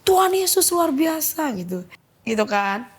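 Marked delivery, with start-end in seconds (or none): 0.1-1.5 high, drawn-out mock shout; retold exclamation. Neighbour praising God on seeing the sick girl, high mock shout. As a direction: imitate a slow cry of praise, pitched up. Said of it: "Terus dia teriak".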